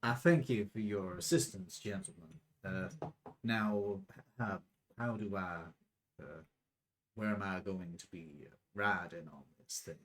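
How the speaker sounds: put-on low-pitched South American accent. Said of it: "could you give me a South American accent".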